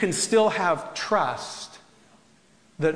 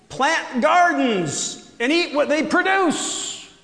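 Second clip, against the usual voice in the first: booming voice from sky; 8 semitones higher, 3 dB louder, slower than usual. Acted. Continues voicing God.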